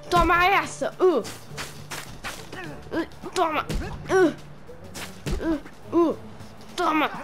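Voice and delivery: socando com esforço agudo; fist fighting. Acted. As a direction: grunhidos de soco. Golpes na luta. (luta de socos)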